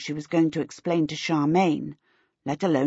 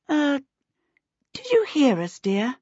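a touch of timid worry, higher; anxious; 8 semitones higher than usual. Acted. Missus Baker anxiously asking her reading daughter. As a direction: ask hesitantly, afraid of the answer. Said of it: "Missus Baker said anxiously".

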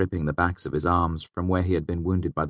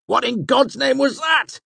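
loud, high, slow shouted demand; shouting demand; 7 dB louder than usual. A narrator's reading. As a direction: yell it out, shocked and hurt. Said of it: "he demanded".

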